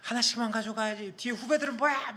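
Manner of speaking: angry coach scolding. Retold or imitated. Coach scolding students who held up the meal line, mock angry. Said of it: "막 화를 내시는 거예요".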